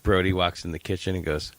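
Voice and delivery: ordinary delivery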